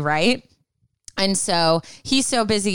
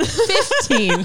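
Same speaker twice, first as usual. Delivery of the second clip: laughing; 10 semitones higher than usual. Real, not acted. Laughing at podcast goal.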